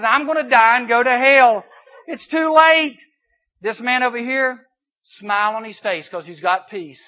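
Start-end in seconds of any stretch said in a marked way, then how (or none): 0.0-3.0 loud, high panicked cry; scared of hell. Acted. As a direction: plead in terror. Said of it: "scared of hell".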